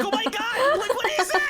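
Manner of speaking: mock shocked mom. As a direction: recoil at the strange plate in mom's voice. Mom sees whole shrimp served.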